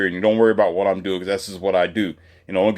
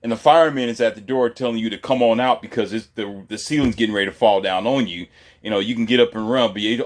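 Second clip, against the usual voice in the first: ordinary talk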